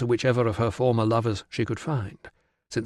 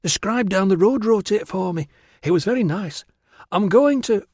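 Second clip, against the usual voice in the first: put-on high, delighted girlish voice; proudly gush, pitched well up; beaming girl; 9 semitones higher than usual. Virana boasts to Tilla about her letter, beaming. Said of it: "Virana beamed".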